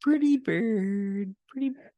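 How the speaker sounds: high squawky parrot, slow. Pretend. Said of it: "One more time".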